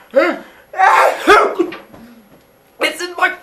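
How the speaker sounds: high, loud startled yelp that cuts off; startled gasp. Acted. Cronus gasping as the baby slips down his throat. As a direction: caught off guard mid-swallow.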